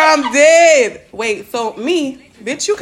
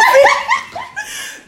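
high squealing laugh. Real, not acted. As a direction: shriek with laughter. Bursts out laughing.

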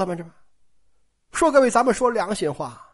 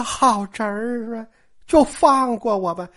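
slow, drawn-out begging; wheedling appeal to a captor; pleading; slower than usual. Acted. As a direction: beg to be let off. Caught man begs his nephew.

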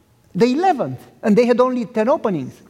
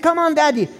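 pleading son; 6 semitones higher than usual. Retold or imitated.

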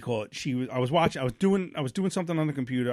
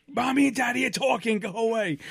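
high, dismissive shoo-away voice; hammed-up parent shooing kids out; 7 semitones higher than usual. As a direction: brush the kids off, mock-impatient.

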